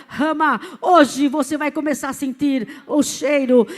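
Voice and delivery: loud, emphatic pulpit delivery; forceful church testimony with punched key words; fervent testimony preaching. Real, not acted. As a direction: proclaim each phrase to the congregation.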